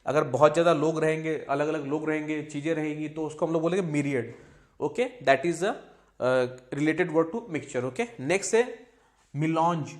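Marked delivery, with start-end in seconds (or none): none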